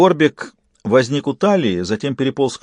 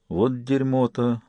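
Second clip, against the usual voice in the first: slow, soft, stunned mumble; dazed, quiet dismay; bewildered mutter; 6 dB quieter, slower than usual. A narrator's reading. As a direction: mutter it slowly, at a loss. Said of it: "растерянно пробормотал Джек".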